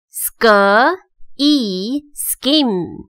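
"scheme" in parts: "sk", long "ee", then whole; sounding out. Shown as a demonstration. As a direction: blend "sk" and "ee" into "scheme" with short gaps. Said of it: "再来复习一次".

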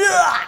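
mock frustrated groan with a laugh; half-laughing exasperated ugh. Angry groan in retelling.

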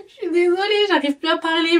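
rire gêné. Real, not acted. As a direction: apologise through a giggle after losing your words. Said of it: "je sais plus parler". (excuse en riant, voix plus aiguë et plus forte)

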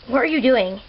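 ordinary delivery

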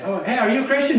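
mock question. Acted dialogue of denial.

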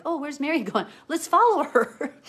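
high, loud mock-excited followers; laughing imitation of nosy followers; eager tagalong crowd. Mocking crowd tagging along after Mary.

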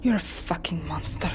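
low, whispered furious insult; seething whispered curse; hissed curse. Acted. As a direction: spit it under your breath. Prisoner curses the dictator.

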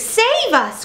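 high-pitched plea to be rescued; cry for help. A narrator's reading. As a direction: cry out high in alarm, without much extra volume. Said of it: "cried another".

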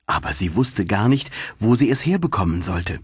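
ordinary delivery

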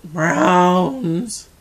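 loud, drawn-out exclamation; mock complaint. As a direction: belt out the word in comic protest. Repeats mock complaint about browns.

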